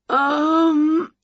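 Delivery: high, drawn-out uneasy "um"; queasy witch hesitating. A narrator's reading. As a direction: hum it as your tummy turns. Winnie turns green, clutches tummy.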